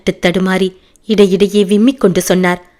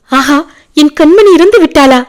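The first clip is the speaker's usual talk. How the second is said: high, loud shriek of dread; screaming in horror; 8 semitones higher, 7 dB louder than usual. A narrator's reading. Father hearing his daughter died, loud horrified shriek. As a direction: scream the question out in shock. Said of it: "என்று அலறிக்கொண்டு".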